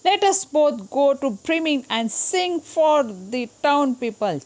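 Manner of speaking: slow, loud, high donkey's offer; eager donkey. Acted. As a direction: call the dog along as the donkey. Donkey invites the dog. (धीमी, ऊँची गधे की पुकार)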